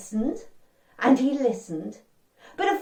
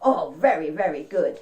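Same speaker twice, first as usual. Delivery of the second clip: ordinary delivery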